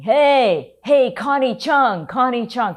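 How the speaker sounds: high, loud jeering call across the street; mock the reporter by calling out a name; heckler taunt. Rowdy concertgoers taunting the reporter, high loud jeer. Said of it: "I hear this group of guys to the side, and they're saying".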